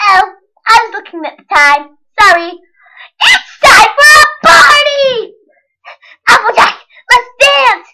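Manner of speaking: high, loud hyper party pony; Pinkie Pie. Pretend. Kid imitating Pinkie Pie, high and hyper. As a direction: bounce through it like a sugar rush. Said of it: "her name is Pinkie Pie".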